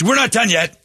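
high-pitched mock nagging wife; mimicked wife's scolding. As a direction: cut him off in a high, scolding imitation. Mimics wife snapping mid-quarrel.

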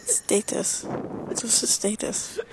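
ordinary talk, nothing marked

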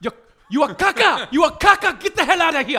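very loud, high, hammed-up French accent; Ludo Lefebvre. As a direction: yell like an angry chef in a bad French accent. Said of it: "can't do good French accents".

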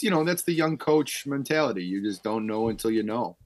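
ordinary delivery

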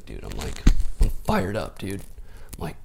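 ordinary talk, nothing marked

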